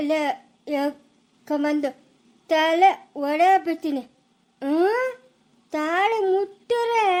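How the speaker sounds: slow, laboured stutter with punched words; halting and emphatic; stammered threat. Acted. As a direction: force the warning out syllable by syllable. Stammering man threatens anyone touching her.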